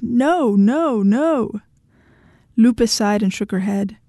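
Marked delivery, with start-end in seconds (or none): 0.0-1.6 wailing, swooping cries; shouted refusal. A narrator's reading. Husband refusing to hear his wife, wailing shout. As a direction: wail each word in protest. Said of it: "he shouted".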